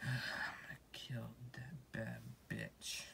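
slow whispered vow; slow whispered revenge vow; vengeful whisper. Acted. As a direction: hiss the vow slowly. Plotting revenge.

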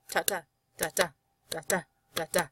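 soft clip-clop "ta-ta" in paired beats; horse hoofbeat imitation. Shown as a demonstration. Teacher imitates coconut horse from Monty Python, soft hoofbeats. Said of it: "that's the sound of a horse moving".

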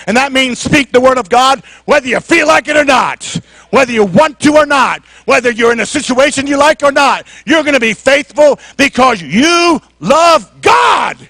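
rising, emphatic preaching crescendo; building preaching. Real, not acted. Preacher urging faithfulness to the congregation, building emphatically.

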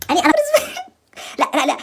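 high laugh breaking the words; cracking up mid-word; giggly high protest. Real, not acted.

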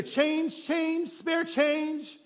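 slow, high beggar's plea for spare change; drawn-out high begging call. Acted. Blind beggar pleading with passersby for change, high and slow.

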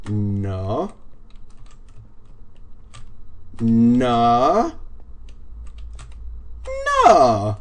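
long, stretched-out silly "no"; drawn-out goofy no. Pretend. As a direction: draw out a playful no. Drawn-out silly no while playing.

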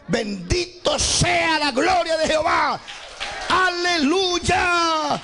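high, drawn-out revivalist shout; rally the congregation with a soaring cry; preacher's full-throated hallelujah call; shouted praise. Real, not acted. Preacher rousing congregation to praise God.

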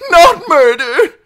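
very high, loud, slow protest; shrill. A narrator's reading. Captive denying murder to outlaws, shrill. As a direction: deny it shrilly. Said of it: "His voice was shrill".

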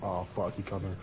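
hushed and tense; whispering while hiding. Real, not acted. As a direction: keep it down, he's close. Hiding as monster approaches.

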